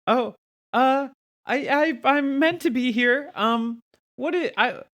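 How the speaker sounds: put-on high, rushed nerdy girl voice; nervous Velma voice. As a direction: play Velma, flustered. Plays Velma in Scooby game.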